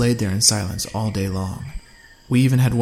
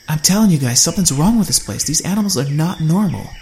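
higher, louder frantic warning; 6 semitones higher, 5 dB louder than usual. A narrator's reading. Allie warning the group, frantic and scared. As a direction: urge them, scared of the place. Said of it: "as she frantically searched the sky".

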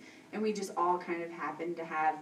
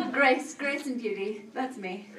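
laughing; 5 semitones higher than usual. Real, not acted. Laughing at her own clumsiness.

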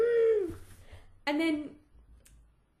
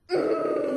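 despairing groan; 8 dB louder than usual. Real, not acted.

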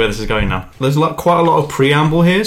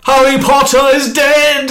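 high, slow shouted villain line; Voldemort impression; 13 semitones higher, 6 dB louder than usual. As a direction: shout it slowly like the Dark Lord. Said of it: "Which is like".

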